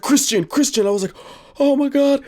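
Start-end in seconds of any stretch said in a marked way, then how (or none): 0.0-0.9 higher, worried calling; retold worry. Calling the friend who fell, retold worried cry. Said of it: "I was hella worried". 1.6-2.2 high, alarmed exclamation; retold worry. Retelling seeing the friend fall, high worried cry. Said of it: "I was hella worried".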